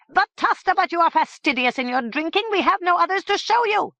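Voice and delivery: high, loud complaining head; high head voice, fed up; exasperated head. Acted. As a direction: push back, exasperated. Head pleads with Sir Pompom.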